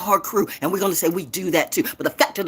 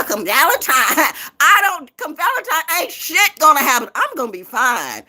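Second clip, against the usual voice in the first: normal talking voice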